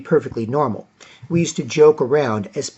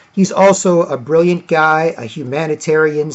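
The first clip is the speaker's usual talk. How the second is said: ordinary delivery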